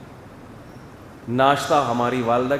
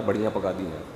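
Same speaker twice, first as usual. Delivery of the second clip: put-on lower, softer sulky child's complaint; 8 semitones lower, 6 dB quieter than usual. Boy sulks to his mother about the dinner, lower and softer whine. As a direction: grumble it like a disappointed boy. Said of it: "اماں کیا پکا دیا یار".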